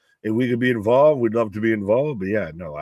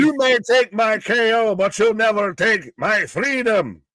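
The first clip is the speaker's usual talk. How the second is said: mock Braveheart speech; 7 semitones higher than usual. Braveheart parody speech, higher.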